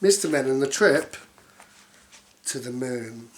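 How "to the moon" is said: ordinary delivery, nothing marked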